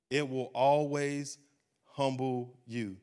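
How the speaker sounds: it will always humble you, drawn out slowly; slow emphasis. Acted. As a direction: lean on every word. Preacher stresses warning.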